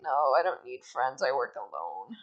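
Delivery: low, soft loner cat voice; Puss. Retold or imitated. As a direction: drop low and refuse company. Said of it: "And Puss is like".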